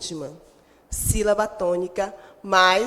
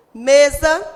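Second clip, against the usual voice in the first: "Mesa" with a loud, higher me; stress on ME; 4 semitones higher, 7 dB louder than usual. Shown as a demonstration. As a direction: hit the first syllable of "Mesa". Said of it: "a sílaba tônica é o me". ("Mesa" com o me forte e mais agudo)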